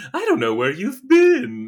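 high, loud prissy Joker quip; manic Joker. Retold or imitated. Joker brushing off the old mobster, high manic disgust. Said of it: "quickly follows up with".

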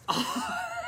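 a high burst of laughter; real laugh at own misfortune; high rueful laugh. Real, not acted. Laughing at own bad luck.

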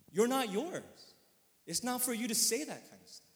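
loud, high, forceful exhortation; impassioned preaching. Real, not acted. Preacher reminding congregation they belong to God, impassioned. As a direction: drive each phrase home from the pulpit.

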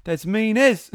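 high, loud mock Kiwi exclamation; mock Kiwi slang. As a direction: blurt it out in a hammed-up Kiwi accent. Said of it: "imagine if he said that".

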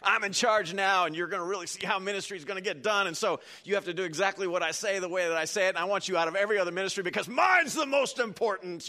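loud, puffed-up new minister barking orders; bossy young leader. Retold or imitated. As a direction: lord it over everyone, high and loud. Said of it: "and they're like".

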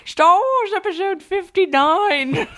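put-on high, slow old lady voice; slow, high granny announcing. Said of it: "You do a good old lady voice".

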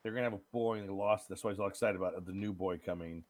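ordinary delivery

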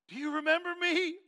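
loud, high, overwrought question; imitated tearful outburst. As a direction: cry it out, acting overwhelmed. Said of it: "he's crying, slobbering, snotting".

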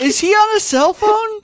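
very high, loud, laughing mock question; squeaky laughing voice.

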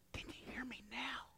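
unvoiced hushed whisper. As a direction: barely breathe the question. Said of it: "a gentle whisper".

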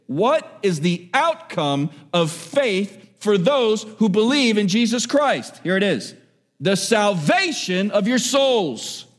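preaching. Acted. Preacher reading scripture to the congregation, fervent. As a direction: preach it to the church with fervor.

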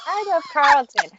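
a teasing friend's lovestruck impression; mock swooning Migdalia. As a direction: tease her by gushing it high. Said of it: "I make fun of Migdalia a lot whenever she's like".

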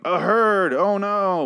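high, loud mock alarm; hammed-up cry of a herd sighted; mock panic. Characters spotting a zombie herd, mock panic. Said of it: "they look up and they're like".

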